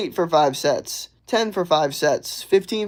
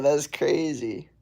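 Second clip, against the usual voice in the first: ordinary delivery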